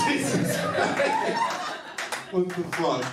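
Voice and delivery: high squealing laugh on Jesus Christ; slow, high laughing exclamation. Real, not acted. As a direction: crack up in disbelief. Disgust at the story.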